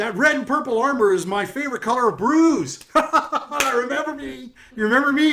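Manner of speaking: high, loud insult-comic voice; brash heckling jokester. Acted. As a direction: play a boisterous greeter roasting the guests. Voices paper-bag jokester figure.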